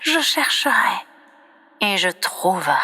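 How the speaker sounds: normal talking voice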